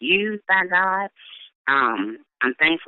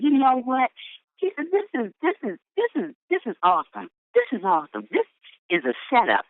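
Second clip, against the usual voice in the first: excited man voice; 9 semitones higher than usual. Retold or imitated. Man voiced, high and far.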